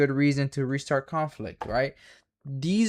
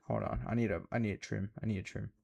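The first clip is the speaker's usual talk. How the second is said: muttered under breath; quiet aside; 5 semitones lower, 11 dB quieter, faster than usual. Real, not acted. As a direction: drop it low to yourself. Aside while adjusting trade.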